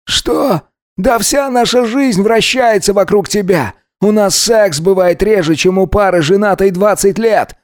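high-pitched, sustained indignant tirade; angry bark. A narrator's reading. Alex fires back at his lover's reproach, barking angrily. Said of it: "рявкнул Алекс".

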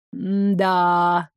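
long, low drawl on one note. A narrator's reading. Sumrak reacts to the heroine's stalled plan, drawling. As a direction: stretch it out low and level, pondering. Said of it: "протянул Сумрак".

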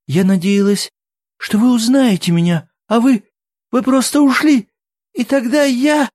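very high, loud pleading; distressed. A narrator's reading. Woman tells men they simply left her, distressed.

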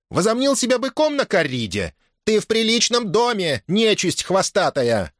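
high-pitched aunt telling off her nephew; indignant scolding. A narrator's reading. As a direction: scold high, dragging out the final insult. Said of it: "воскликнула Теодора". (возмущённая брань, высоким голосом)

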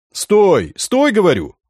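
loud call to stop; shouting after someone. A narrator's reading. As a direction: yell it after a running boy. Chasing the fleeing boy.

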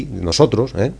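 ordinary delivery

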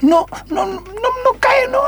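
hammed-up excited sobbing; overjoyed mother crying theatrically; mock sobbing. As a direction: fake tearful squeal, rising high.

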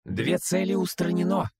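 squeaky high droid voice; rubbery high squeaky toy. Acted. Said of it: "пропищал дроид неуместным высоким голосом".

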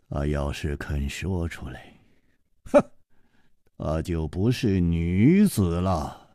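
put-on calm older hero. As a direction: speak low and unhurried, gently reproaching. Chu Liuxiang reveals.

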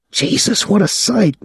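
put-on high, excitable young man's voice; a gawking kid, pitched high; young Claude, leering. A narrator's reading.

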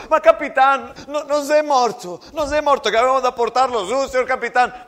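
frantic soldier shouting, fast in the middle; desperate shout. Acted. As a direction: beg the captain at the top of your voice. Soldier pleads to save friend.

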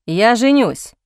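firm, emphatic announcement; a measured, resolute declaration; crisply declared. A narrator's reading. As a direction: state it word by word, decisively. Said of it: "отчеканил Джозеф".

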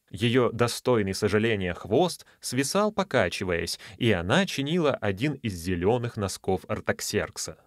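plain reading voice

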